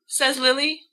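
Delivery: usual speaking voice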